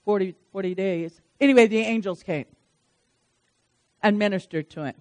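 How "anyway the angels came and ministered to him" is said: usual speaking voice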